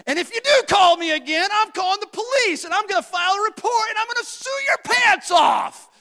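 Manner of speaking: very high, loud shouted threat; angry yelling. Retold or imitated. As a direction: yell it out at full force, furious. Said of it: "And I continued".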